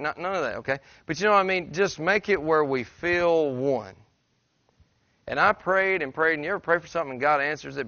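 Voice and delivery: fervent preaching. Real, not acted. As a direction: push it out to the whole church with conviction. Pastor preaching to congregation.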